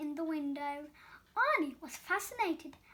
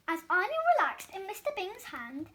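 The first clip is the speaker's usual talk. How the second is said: ordinary delivery, nothing marked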